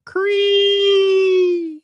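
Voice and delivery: high shrieking dinosaur cry; pterodactyl screech. Pretend. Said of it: "pterodactyl impression".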